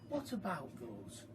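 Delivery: ordinary delivery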